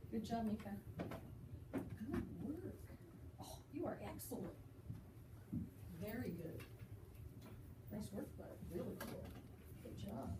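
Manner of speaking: murmured encouragement to students; soft praise. Real, not acted. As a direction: quietly praise each one as you walk by. Quiet praise while students write.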